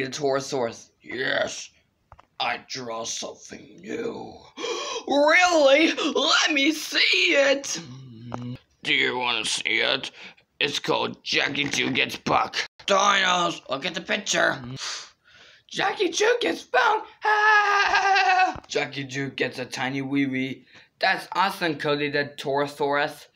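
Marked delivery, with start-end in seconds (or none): 4.6-7.8 excited gasp. Pretend. 15.7-18.6 squealing laugh. Pretend. High laughing shout.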